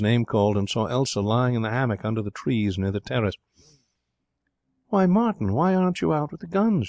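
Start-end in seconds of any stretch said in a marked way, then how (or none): 4.9-6.9 high, lilting surprised greeting; light, high-pitched feminine astonishment; pleasant surprise. A narrator's reading. Girl greets young man with surprise.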